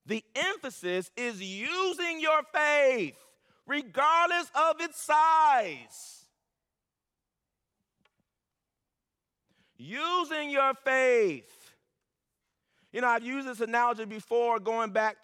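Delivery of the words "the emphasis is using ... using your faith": using your faith, drawn out slow and high; slow emphatic preaching. Acted. Preacher driving home the point to his church, slow and emphatic. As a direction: stretch every word, lean on your.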